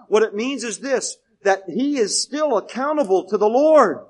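loud sermon delivery with punched key words; emphatic preaching. Real, not acted. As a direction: drive the point home to the congregation. Preaches at full force.

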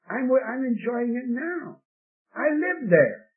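high-pitched, emphatic reply; emphatic insistence. Retold or imitated. Man answering the tract distributor, high and insistent.

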